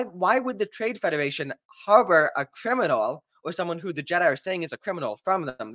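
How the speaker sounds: usual speaking voice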